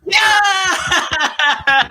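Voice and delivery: high, loud cheer with a laugh; excited shout. Real, not acted. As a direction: cheer out the win. Celebrating a matching answer.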